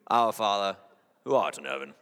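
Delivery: low, theatrical English praying voice; mock Shakespearean English accent. Preacher mocks Kentuckian praying in a stagey English accent. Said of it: "in a Shakespearean play".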